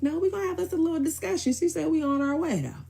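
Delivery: high-pitched retold warning; high, emphatic mimicked comeback; mother's retort. Imitating mother on the phone.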